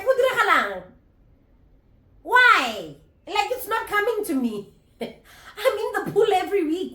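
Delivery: high, loud, laughing frustration; exasperated whine. Real, not acted. Vlogger laments to viewers she still can't swim, high and loud. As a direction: plead to the heavens with a half laugh.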